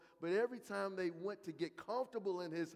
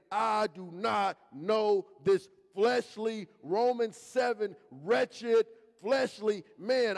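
slow, loud disowning; renouncing the flesh; 3 semitones higher, 8 dB louder, much slower than usual. Retold or imitated. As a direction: spit out the disowning word by word. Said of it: "we're saying".

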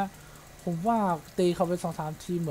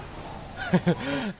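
nervous laugh; 7 semitones higher than usual. Real, not acted. Laughing at his own blunder.

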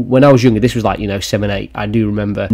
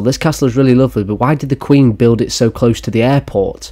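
ordinary talk

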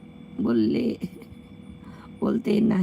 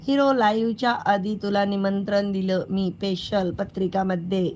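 usual speaking voice

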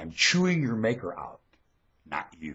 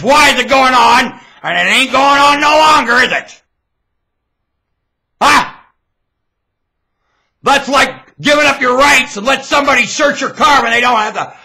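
high, very loud haranguing; angry high-pitched yelling; shouted tirade; 13 semitones higher, 13 dB louder than usual. Real, not acted. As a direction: harangue the crowd at full voice.

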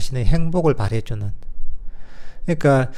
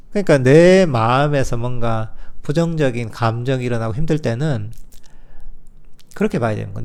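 ordinary talk

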